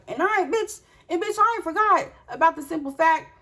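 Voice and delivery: high-pitched indignant outburst; heated. Retold or imitated. Mother confronting the woman who insulted her mama, heated. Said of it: "I'm hot at the fact".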